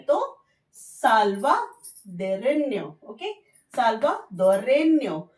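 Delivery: साल्वाडोरेño said very slowly, ño as nyo; ñ sound. Shown as a demonstration. Teacher demonstrates the ñ to students, very slow. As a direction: stretch out साल्वाडोरेño, nyo at the end. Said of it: "pronunciation न्यो निकलता है".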